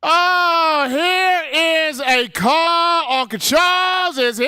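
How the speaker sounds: high, drawn-out shout to welcome the caller; hyped announcement. Real, not acted. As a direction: belt it like a ringside announcer. Host hypes star caller's arrival.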